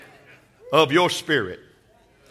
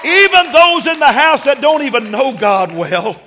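preaching building to a shout; 5 semitones higher than usual. Acted. Preacher declaring Jesus' promise to the house, building to a shout.

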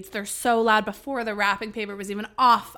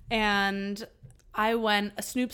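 ordinary talk

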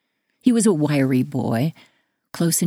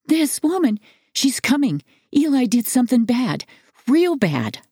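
high, urgent blurts in short bursts; a boy running in with alarming news, pitched high; panting child; 7 semitones higher than usual. A narrator's reading.

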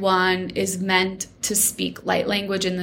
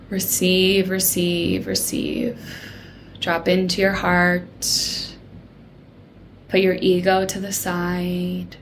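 soothing massage murmur. Acted. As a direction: ease them into calm, gently. Leads a meditation.

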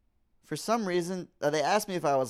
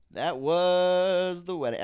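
slow, louder line said off-mic; drawn out, away from the mic; 4 semitones higher, 4 dB louder, slower than usual. Shown as a demonstration. Wrapping up the wedding story, drawn out off-mic. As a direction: stretch it like Bill Burr stepping back. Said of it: "why do I do that with my voice?".

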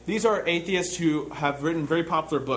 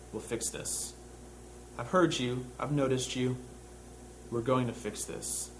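softer, near-whispered promise; hushed inner voice; 5 semitones lower, 8 dB quieter than usual. Pretend. God quietly promising his oppressed people, hushed and tender. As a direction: murmur it like a private vow. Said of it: "As if in the mind of God, he said".

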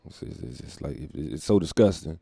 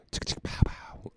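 whispered gunshot noises; 6 dB quieter than usual. Pretend. Whispered gun sound effect.

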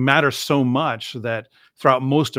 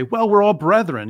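"brethren" carried higher and louder, breezy and dismissive; mock dismissive quote; 8 semitones higher, 5 dB louder than usual. Imitating people who brush aside differences, a higher, louder breezy tone. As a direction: toss off "we're all brethren" as if the differences are nothing. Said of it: "they wanted to say".

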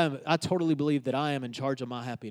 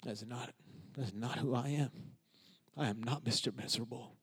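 hushed, low and halting; retold private realisation, barely voiced; inward thought; 5 semitones lower, 13 dB quieter than usual. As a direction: think it aloud very softly, with pauses. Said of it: "And I thought".

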